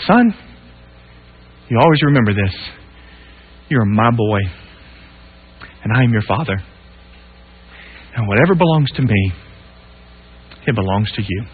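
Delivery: low, soft and unhurried like a father's blessing; tender, hushed father. Retold or imitated. Father quietly telling his son what matters. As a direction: lay each word down gently to your boy.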